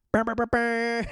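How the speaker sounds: loud, high rolled horn noise; imitated horn blasts. Recapper cheers Karen's insult, imitated horn blasts. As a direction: trill out a hype air-horn burst.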